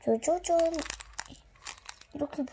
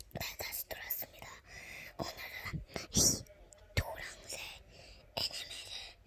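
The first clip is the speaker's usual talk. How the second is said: soft whispered ASMR voice; 9 dB quieter than usual. Acted. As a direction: whisper close to the mic.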